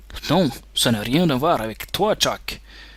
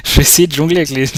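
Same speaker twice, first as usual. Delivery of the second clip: laughing; 7 dB louder, much faster than usual. Real, not acted. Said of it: "c'est trop dur".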